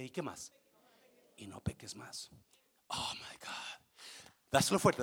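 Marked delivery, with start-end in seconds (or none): 1.4-2.3 hushed whisper. Acted. Jesus quietly tells the woman to sin no more, whispered. 2.9-3.9 whispered in awe; awed whisper. Real, not acted. Preacher, moved by the story, whispers in awe. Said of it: "awed aside".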